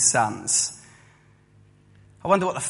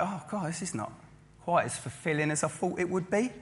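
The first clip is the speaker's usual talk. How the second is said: softer, let-down inner voice; treated themselves and now feel empty, softer deflated murmur; deflated sigh; 2 semitones higher, 10 dB quieter than usual. Retold or imitated. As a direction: sag as the fun wears off. Disappointed self-indulgent person inside.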